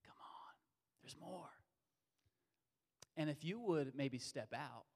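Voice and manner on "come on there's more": breathy whispered invitation; gentle whisper. Retold or imitated. The Lord inviting a lukewarm believer, in a hushed whisper. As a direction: coax softly, almost whispering. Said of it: "And the Lord's saying".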